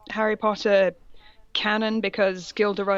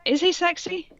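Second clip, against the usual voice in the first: incredulous; 10 semitones higher than usual. Real, not acted. Incredulous question about Manson.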